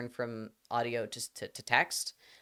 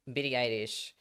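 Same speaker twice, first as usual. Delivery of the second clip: put-on Japanese accent. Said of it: "you have to pronounce anything that's English in, like, an accent".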